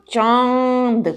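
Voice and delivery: चांद drawn out with a nasal vowel. Shown as a demonstration. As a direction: let चांद resonate from inside the nose. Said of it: "वो अंदर से निकलती". (चांद, लंबा चंद्रबिंदु)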